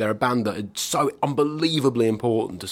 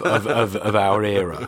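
ordinary talk, nothing marked

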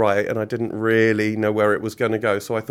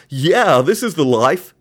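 louder, higher stranger's voice; another voice; 4 semitones higher, 7 dB louder, slower than usual. Acted. Hidden man joining the two villains, odd higher voice. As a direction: chime in as an unexpected third character. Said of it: "said another voice".

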